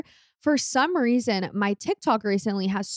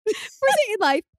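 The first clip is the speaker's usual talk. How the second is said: high-pitched, through real laughter; laughing; 12 semitones higher than usual. Real, not acted. Teasing over a confessed first date, laughing. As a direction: let the giggles push your voice right up.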